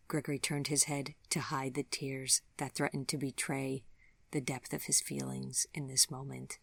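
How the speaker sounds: plain reading voice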